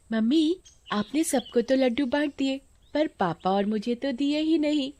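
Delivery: put-on high-pitched little child voice; complaining child. Child asks mother for her share of laddus, complaining child voice.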